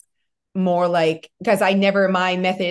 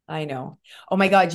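ordinary talk, nothing marked

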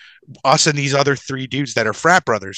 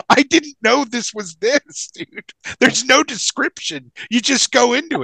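laughing; 10 semitones higher, slower than usual. Retold or imitated. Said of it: "I can't stop laughing".

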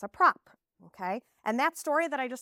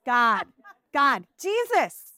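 put-on child's wrong answer; 2 semitones higher, 9 dB louder, much slower than usual.

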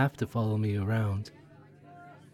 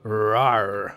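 drawn-out mock roar; playful lion roar; 4 semitones lower than usual. Conductor roaring at her reflection in the glass, playful. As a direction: growl like a toy lion. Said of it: "Conductor Lion thanks".

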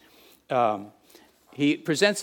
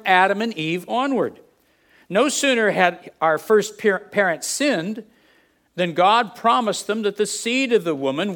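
higher and louder, driving each point home; full-force preaching; 7 semitones higher, 7 dB louder than usual. Acted. Pastor pressing the gospel plan on the church, full-force preaching. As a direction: preach it out to the back row.

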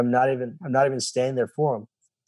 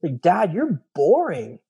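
put-on child voice; 5 semitones higher, slower than usual. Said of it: "he's like".

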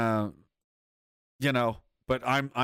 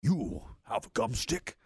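slow menacing butcher growl; 5 semitones higher, slower than usual. Retold or imitated. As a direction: read it like the gangster butcher. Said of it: "Cue up the butcher voice".

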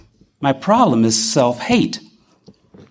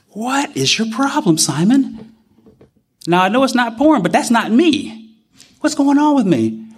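exasperated self-scolding; 7 semitones higher than usual. Retold or imitated. Scolding himself in his head.

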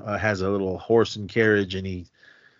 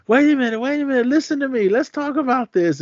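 pleading wife. Retold or imitated. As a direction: imitate a wife begging him to stop. Wife following husband, pleading.

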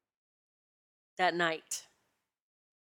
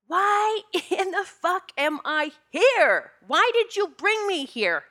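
retold scream, much higher and louder; screaming at God; 12 semitones higher, 5 dB louder, slower than usual. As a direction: re-enact an exasperated yell up at God. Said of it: "I am screaming at God and saying".

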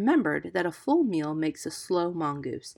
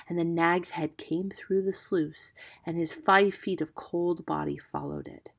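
usual speaking voice

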